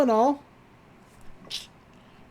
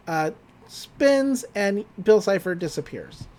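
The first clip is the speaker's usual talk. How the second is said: usual speaking voice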